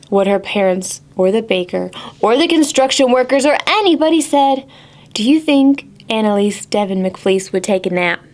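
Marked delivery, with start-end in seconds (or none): none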